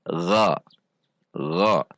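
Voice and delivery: "gha" from the tongue root, lips unrounded. Shown as a demonstration. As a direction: keep lips loose on "gha". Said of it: "dengan tidak membulatkan bibir". ("gha" tanpa membulatkan bibir)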